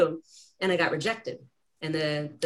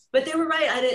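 ordinary talk

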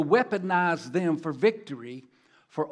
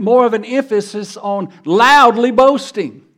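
"loudly" punched much louder; stress on loudly; 4 semitones higher, 14 dB louder than usual. Shown as a demonstration. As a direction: hit the word "loudly" hard and loud. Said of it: "I'll put the emphasis on the word loudly".

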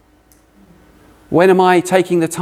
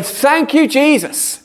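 grateful prayer; 11 semitones higher, much slower than usual. Retold or imitated. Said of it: "you say".

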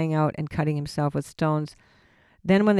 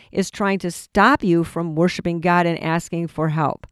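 ordinary talk

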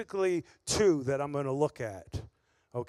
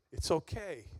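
gentle reassurance; 4 semitones lower, 6 dB quieter than usual. Retold or imitated. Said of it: "the Lord saying".

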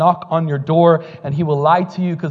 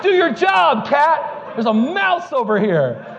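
high-pitched shout at a cat; mock yelling; 8 semitones higher, slower than usual. Husband orders the cat after a mouse, mock yelling. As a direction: call out a comic order, easing off at the end.

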